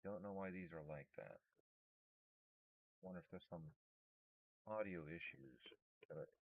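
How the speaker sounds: soft, private wondering aloud; talking low to oneself. Real, not acted. Streamer wondering about audio issues, talking low to himself. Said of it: "I keep talking low".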